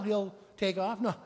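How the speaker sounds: ordinary talk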